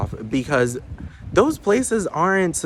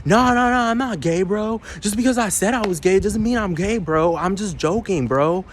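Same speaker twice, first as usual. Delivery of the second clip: higher, whiny mocking bro voice; mocking commenter; 7 semitones higher than usual. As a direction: whine it like a defensive bro. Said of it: "people in the comments talking about".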